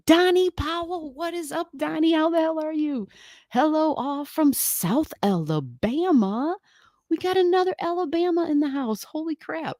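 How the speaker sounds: high, thrilled welcome to a regular; greeting a viewer from South Alabama, high and excited. Real, not acted. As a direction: squeal hello to a new viewer from home.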